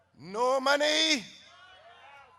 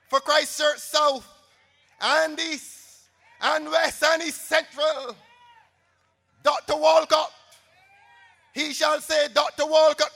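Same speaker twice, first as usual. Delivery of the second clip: rousing proclamation; 3 semitones higher, 5 dB louder than usual. Real, not acted. Rally speaker's victory proclamation.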